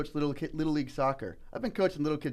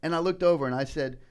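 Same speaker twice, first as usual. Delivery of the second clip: usual speaking voice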